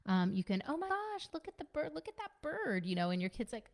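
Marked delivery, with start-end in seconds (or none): 0.7-2.8 much higher, bright, sing-song excitement; excited distraction. Acted. Parent distracting an upset kid with a bird, excited and high. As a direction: pull the kid's attention with big wonder.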